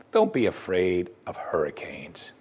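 low, slow, half-whispered; slow right down and hush it; hushed reassurance. Acted. Jesus to us about hurricanes, hushed reassurance.

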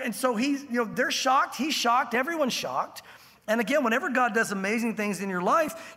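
usual speaking voice

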